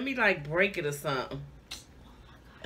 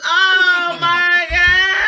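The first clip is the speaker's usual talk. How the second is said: distressed outcry; 6 semitones higher, 9 dB louder, much slower than usual. Real, not acted. Dreading next food.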